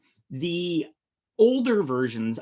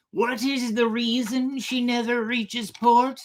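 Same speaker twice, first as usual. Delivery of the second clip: second pirate; 12 semitones higher, slower than usual. Acted. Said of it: "the other pirate replied".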